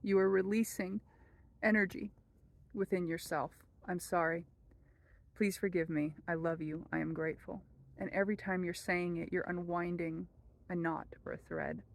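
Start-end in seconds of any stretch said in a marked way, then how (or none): 3.9-7.6 soft prayer. Shown as a demonstration. Reciting the prayer.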